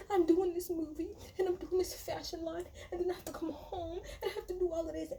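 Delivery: high-pitched mock complaint; Kyle. Recapper mimics castmate listing her workload, high mocking whine. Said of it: "because Kyle is still saying".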